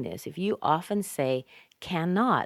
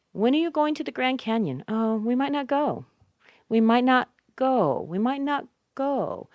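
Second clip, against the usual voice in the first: higher-pitched example question and answer; teacher playing both sides of a chat; acted model dialogue; 8 semitones higher, 4 dB louder than usual. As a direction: act out the exchange, lifted and lively.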